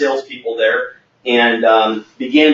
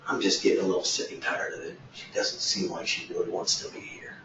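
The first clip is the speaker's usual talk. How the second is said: whispered grumble; 7 semitones lower, 14 dB quieter than usual. Retold or imitated. Owner confiding about employee.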